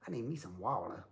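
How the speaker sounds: put-on "water" drawled as "wall-duh" in a light New Jersey accent. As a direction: play a Jersey local asking for "water". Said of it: "Why would you say".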